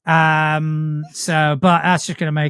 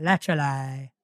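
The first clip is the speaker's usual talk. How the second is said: Essex accent; 2 semitones lower, 7 dB quieter than usual. Shown as a demonstration. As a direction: say "literally" in Essex without moving your mouth. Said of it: "you move your mouth without moving your mouth".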